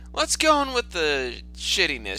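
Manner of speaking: normal talking voice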